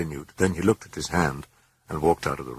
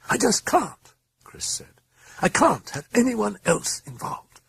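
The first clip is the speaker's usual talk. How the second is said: high, pleading mother; desperate. A narrator's reading.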